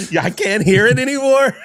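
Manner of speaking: high, loud laughing words. Real, not acted. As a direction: laugh it out as you talk. Laughing at accent joke.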